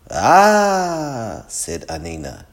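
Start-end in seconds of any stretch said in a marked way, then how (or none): 0.1-1.4 long, high, loud "aaah"; drawn-out "aah". A narrator's reading. Anina reacting to a great love story, drawn-out high aah. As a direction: let out a big swooning aah.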